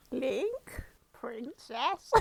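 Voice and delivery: a hint of a frail old lady. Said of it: "I'll do the old lady voice".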